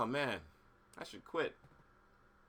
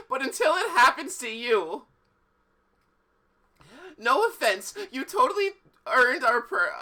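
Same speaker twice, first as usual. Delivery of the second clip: loud, higher, defensive politician; indignant mayor; 10 semitones higher, 6 dB louder than usual. Acted. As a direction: protest with injured pride.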